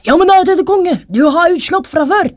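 calling from the back. A narrator's reading. Soldier objects to the king from the back, calling out. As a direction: pipe up from the crowd. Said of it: "en av mennene bak i flokken ropte".

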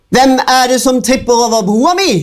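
a high, fast troll screech; the troll shrieks at the goat from under the bridge. A narrator's reading. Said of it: "skrek fossiltrollet".

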